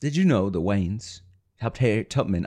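tentative British film-star impression; self-conscious attempt at an actor's voice; Michael Caine. Shown as a demonstration. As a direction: try the famous actor's voice, not quite landing it. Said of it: "in the best Michael Caine impression that you can give us".

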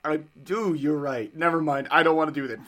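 ordinary delivery

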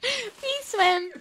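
squeaked high and loud; mock high plea; too high. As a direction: sing it way up high. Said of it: "That was too high".

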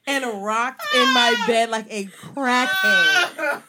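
slow, loud laughing telling; laughing while telling. Real, not acted. Telling friends the heartbreak story, laughing. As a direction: push the words out through laughter.

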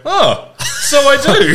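very high, drawn-out exclamation through laughter; mock delighted realisation. Patient learning he still has his arm, high laughing surprise. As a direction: cry it out slowly, loud and high.

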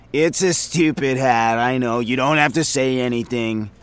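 a rambling, self-amused office guy; chatty coworker voice, in a different timbre from the narrator; Frink, chatty coworker. Acted. Frink's held character voice.

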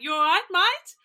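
high, loud Australian greeting; Australian accent. Shown as a demonstration. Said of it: "mostly we just say".

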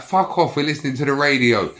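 high-pitched, indignant retort; retold defiance. Backing his mate against praying passengers, retold defiance. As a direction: re-enact firing back in a row.

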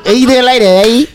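mock smooth flirt. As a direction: ham up the creep's come-on line. Mocking pickup line.

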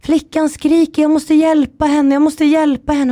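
high, insistent mock-panicked cry. Grandmother desperate to rescue the girl, mock panicked. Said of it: "hon skrek liksom".

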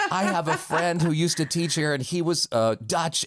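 high, amused imitation of an admirer; gushing fan. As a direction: play the impressed audience member, pitched well up. Acts out admirer praising his accent.

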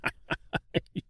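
soft, breaking-out monster laugh; scornful creature laugh. Acted. Giant tree mocking the Tin Woodman, scornful laugh. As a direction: let a creature laugh build from breath.

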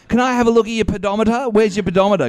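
high, quick patronising voice; mock health-worker questions, pitched well up; physiotherapist impression. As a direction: pester a patient in a singsong. Imitates physiotherapist questioning patient.

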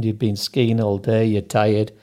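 ordinary delivery

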